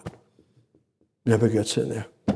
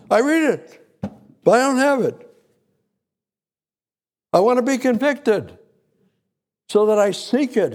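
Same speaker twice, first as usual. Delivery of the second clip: raised, earnest prayer voice, pitched high; fervent prayer; 6 semitones higher than usual. Acted. Preacher praying aloud before the congregation, fervent and raised. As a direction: plead with God from the pulpit.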